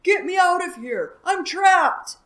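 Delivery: high, loud gruff shout; disguised voice yelling for rescue. Acted. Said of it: "he cried in a gruff voice".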